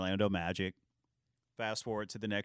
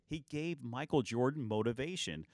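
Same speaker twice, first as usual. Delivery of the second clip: ordinary talk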